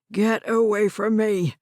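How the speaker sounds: put-on loud angry old man voice. A narrator's reading. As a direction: push her away with the words. Old man pushing her away.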